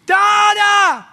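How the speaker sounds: put-on high, loud little boy crying for his dad; imitating a toddler yelling from his bed; child calling out. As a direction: shout it like a small boy calling Dada in the night. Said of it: "when I hear my son Liam say".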